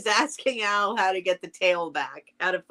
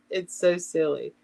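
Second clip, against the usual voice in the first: ordinary delivery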